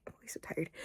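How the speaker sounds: unvoiced whisper to herself; a weary whispered aside. Real, not acted. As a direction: breathe it out as a tired whisper. Whispered repeat of own line.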